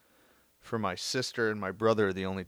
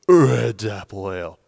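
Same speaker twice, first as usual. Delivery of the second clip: sponsor read; 14 dB louder, slower than usual. Pretend.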